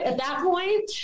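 ordinary talk, nothing marked

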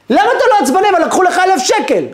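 high, loud disbelieving question; mock astonished onlooker; incredulous. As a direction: act amazed he isn't angry. Astonished bystander questions calm man.

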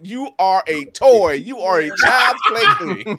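loud, slow, high mock declaration; big high-pitched imitation, drawn out; emphatic quoted put-down. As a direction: hammer out each word slowly, laughing at the end.

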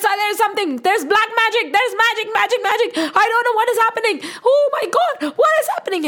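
very high, frantic babbling; hysterical panic. Acted. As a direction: wail in mounting panic, pitch climbing to the end. Panicked king flees the miracle.

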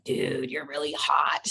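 low, soft, slow appreciative drawl; admiring come-on. Retold or imitated. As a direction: savor it like a flirt. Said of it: "And I was like".

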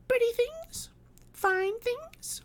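put-on very high, delighted child voice; piping childish enthusiasm; enthusiastic little girl. A narrator's reading. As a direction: show off treasures like a small girl. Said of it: "cried the little girl enthusiastically".

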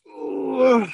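high, loud retold groan; exasperated groan. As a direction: groan in mock frustration. Retold exasperation in street standoff.

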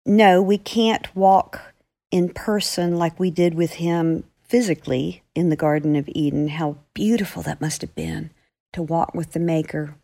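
plain reading voice

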